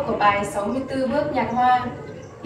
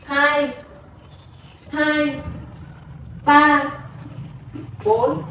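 counting dance beats; 2 semitones higher, much slower than usual. Shown as a demonstration. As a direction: call the beats like a dance teacher. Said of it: "thầy [name] sẽ hướng dẫn đếm nhịp cùng hướng". (đếm nhịp nhảy theo nhạc)

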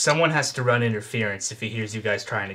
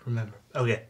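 ordinary delivery

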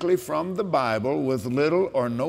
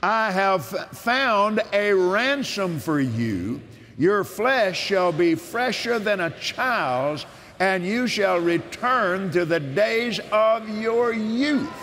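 preaching with force; 4 semitones higher, slower than usual. Acted. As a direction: declare it like a sermon. Proclaims scripture.

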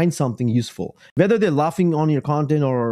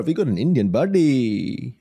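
slow, hammed-up Indian accent; mock Indian accent; 4 semitones lower, slower than usual. Vlogger teasing his own people, mock Indian accent. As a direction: draw it out in a playful Indian accent. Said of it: "I can make fun of my own people".

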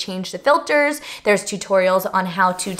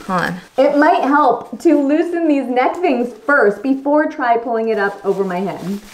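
talking inside a helmet; 3 semitones higher than usual. Real, not acted. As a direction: voice muffled by visor. Seen 5: Clear full-head helmet on; briefly lifted near 2.7-3.3s.

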